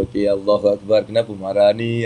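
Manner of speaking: low, softened, soothing question; calm reply. Acted. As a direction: gently placate someone who is angry. Models calm reply to angry person.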